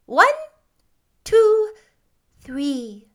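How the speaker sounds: ceremonial, spaced-out count with a touch of solemnity. A narrator's reading. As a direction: count out high and loud, trailing down on the last. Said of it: "in a solemn voice".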